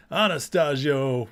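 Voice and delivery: ordinary delivery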